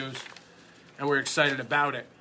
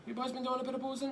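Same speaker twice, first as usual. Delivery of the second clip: character voice; 9 semitones higher, 8 dB quieter, much faster than usual. Pretend. Mock bartender quip.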